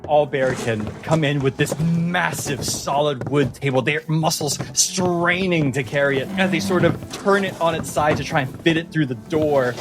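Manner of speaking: usual speaking voice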